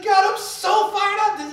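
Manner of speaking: playing David bursting with zeal to God; fired-up David. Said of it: "There's days he's like".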